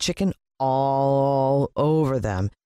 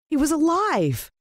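high, slow joyful exclamation; joyful cry; 13 semitones higher than usual. A narrator's reading. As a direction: burst out with joy. Joyful discovery.

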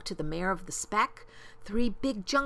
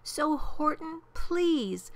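high, earnest little plea; beseeching, pitched-up appeal; pleading; 10 semitones higher, slower than usual. A narrator's reading. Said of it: "pleaded the voice of the mayor".

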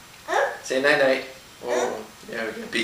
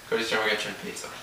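ordinary delivery, nothing marked

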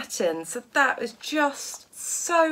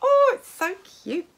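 high coo breaking into laughter; overexcited; 7 semitones higher than usual. Real, not acted. Showing viewers the cute fabric, overexcited. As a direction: squeal at something cute, then laugh. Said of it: "I'm getting so overexcited".

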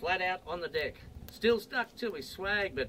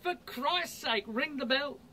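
yelled; 6 semitones higher than usual. Acted. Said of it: "He yelled out to the pilot".

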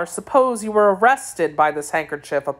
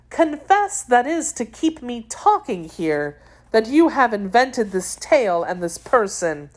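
higher voice, mildly accusing; a touch of challenge, pitched up at the start; lightly voiced young woman; 6 semitones higher than usual. A narrator's reading. Young woman accuses him of inventing tales, mildly challenging.

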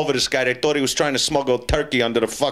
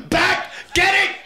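shrieked punchline. Pretend. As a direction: shriek the gag up high.

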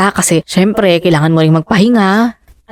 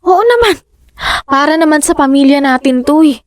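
eager; 7 semitones higher than usual. Acted. Wife eager to help family.

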